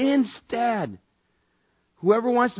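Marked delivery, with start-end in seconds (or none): none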